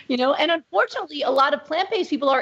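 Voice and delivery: ordinary talk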